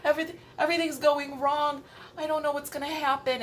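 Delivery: high, fast hammed-up panic; mock panic. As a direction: play your panicked self, rushing. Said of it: "And I'm like".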